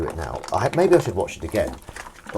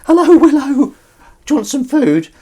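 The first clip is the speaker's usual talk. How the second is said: very high, loud falsetto pet call; cat voice; 11 dB louder than usual. Shown as a demonstration. Host coaxing Willow over with food, high cat voice. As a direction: coax the cat over in a piercing high coo. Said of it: "my cat voice to Willow is".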